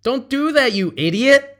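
high, loud scolding; loud, high mock telling-off. Shown as a demonstration. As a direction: tell them off like a fool. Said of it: "You're really saying".

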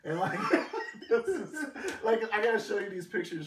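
high laughing burst. Real, not acted. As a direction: crack up while talking.

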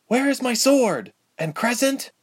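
put-on high-pitched girl's question; anxious demand in a high girlish voice; urgent young patient. A narrator's reading. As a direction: ask urgently, voice jumping up high. Injured girl demands her sword.

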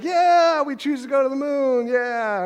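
mock crowd roaring yeah, high and loud; cheering crowd. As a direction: whoop it like a thrilled crowd at the speech. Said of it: "And everybody's like".

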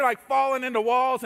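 usual speaking voice

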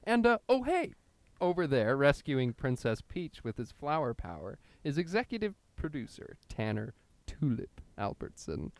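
ordinary talk, nothing marked

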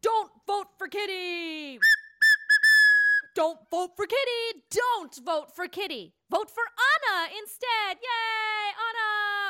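rousing crowd cheer; lively rallying cry; campaign cheer. Acted. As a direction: call it out like a slogan. [name] campaigns aloud for Anna.

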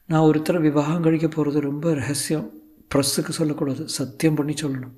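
high, hushed actress confiding; Sulekha. Acted. Actress confiding her secret marriage, high and soft. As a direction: raise the pitch and soften towards the end.

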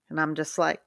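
ordinary talk

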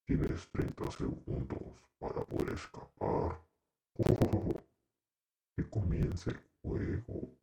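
sinister announcer with an evil laugh; horror game villain. Pretend. Host imitating the horror game's host, sinister threat. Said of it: "el juego de terror".

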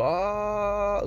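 high, restrained mock scream; imitated scream. Dream woman screams in his face, held-back high mock scream. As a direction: mimic her scream without real volume. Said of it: "Sie schreit nur so".

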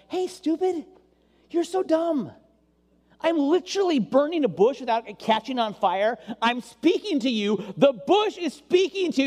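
mock exasperated God. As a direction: tease him like an impatient, exasperated God. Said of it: "God wants to come out and just say it".

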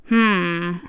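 usual speaking voice

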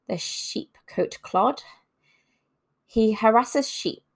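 ordinary talk, nothing marked

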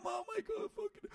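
high-pitched startled cry; terrified yelp. Retold or imitated. Kid seeing his dad deck a man, startled high cry. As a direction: gasp it out in shock. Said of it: "I'm half terrified".

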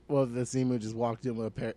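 usual speaking voice

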